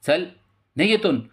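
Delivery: furious policewoman telling him off; angry order to leave. A narrator's reading. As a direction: order him out, loud and high. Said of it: "एकदम चिडत्याने म्हणते".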